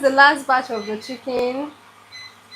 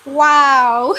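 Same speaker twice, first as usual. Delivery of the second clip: delighted laugh; 6 semitones higher than usual. Real, not acted. Delighted viewers stayed after outage.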